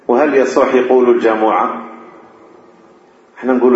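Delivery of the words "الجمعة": الجمعة as "al-jamu'a", a after the jim; colloquial mispronunciation. Shown as a demonstration. Teacher asking if it is valid, الجمعة said as jamu'a. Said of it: "هذه لا أصل لها في اللغة".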